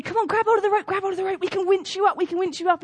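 high, loud urging call; rescuer calling. Retold or imitated. Helicopter crew shouts down to the man, high urging call. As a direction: coax him up to the rope, shouting. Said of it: "and they say".

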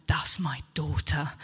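soft, near-whispered revelation; emotional hush. Retold or imitated. Tearful mother tells preacher about her daughter, hushed. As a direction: hush it, overcome but understated. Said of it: "she was in tears, in bits".

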